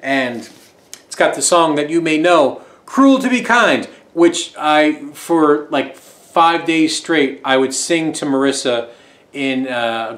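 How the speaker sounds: ordinary delivery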